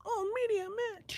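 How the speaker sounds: high falsetto mock moan; squeaky media voice. Reprising the media guy bit in squeaky falsetto. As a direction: squeak it softly, mock-aroused.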